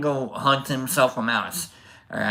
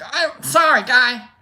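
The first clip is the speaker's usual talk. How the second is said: high apology; 10 semitones higher, 4 dB louder, slower than usual. Retold or imitated. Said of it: "I'm like".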